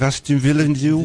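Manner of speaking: put-on Aston Villa nil in a high Brummie accent; hint of a Brummie football results reader. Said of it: "you could do that very well".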